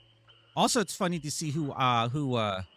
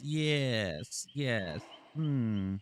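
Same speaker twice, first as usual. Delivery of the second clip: normal talking voice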